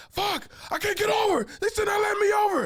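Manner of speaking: high, loud exasperated mock yell; frantic driver. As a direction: wail it like you are stuck in the lane. Acts out panicking driver.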